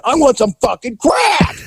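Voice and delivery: high, strained mock yell; retold scream. Addict screaming at dealers for crack, mock shriek. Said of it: "she's screaming".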